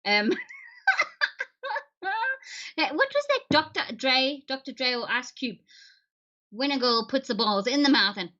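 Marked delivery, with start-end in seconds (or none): none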